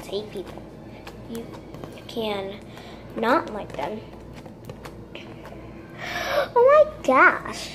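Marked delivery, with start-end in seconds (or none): 5.1-7.7 surprised. Real, not acted. Surprised by sparkly slime.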